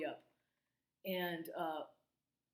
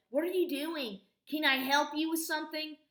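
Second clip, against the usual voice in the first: loud challenging neighbor; 9 semitones higher, 8 dB louder than usual. Retold or imitated. Neighbor confronts him at shed.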